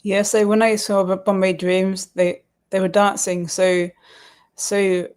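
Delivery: ordinary talk, nothing marked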